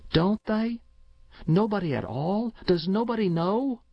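put-on high-pitched, wondering boy's questions; surprised child. A narrator's reading. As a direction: press mother in childlike disbelief. Boy surprised, presses his mother.

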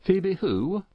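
high, rising farmer's question; lightly voiced puzzled farmer. A narrator's reading. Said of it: "inquired Farmer Dodge, not for the moment connecting the name".

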